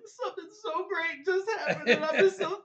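loud, very high tearful blubbering with laughter; mock-sob through overwhelming gratitude; imitated happy crying. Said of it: "I, I'm crying even before".

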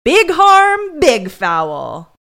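a detective scoffing, turning his words back on him; mock stern rebuttal. Detective shoots down the suspect's excuse, loud and mocking. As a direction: punch each big, high and loud.

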